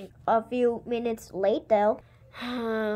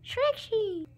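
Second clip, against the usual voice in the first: dinosaur voice; 7 semitones higher, 5 dB quieter than usual. Pretend. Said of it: "I think it's saying Trixie in dinosaur voice".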